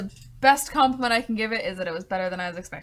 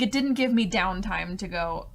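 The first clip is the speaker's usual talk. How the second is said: usual speaking voice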